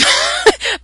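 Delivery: short, high, loud burst of laughter. Real, not acted. Laughing about a little boy using the F word. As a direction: let a quick high laugh escape.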